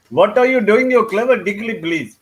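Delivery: hammed-up Brummie voice; Birmingham accent. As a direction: put on a broad Birmingham accent. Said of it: "that was my Birmingham accent".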